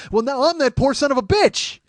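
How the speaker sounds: high, loud cry of exasperation; dismayed shout. Retold or imitated. Contestant realises bankrupt is his own, dismayed shout. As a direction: yell it out in mock anguish.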